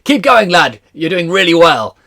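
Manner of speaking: loud, high shouted encouragement; hammered actor cheering from the box; drunk heckler's cheer. Retold or imitated. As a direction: bellow it down from the royal box. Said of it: "suddenly the voice calls out".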